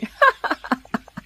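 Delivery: a short, fond chuckle that falls away; light laugh. Acted. Mother-in-law teasing the daughters-in-law. (স্নেহভরা মৃদু হাসি)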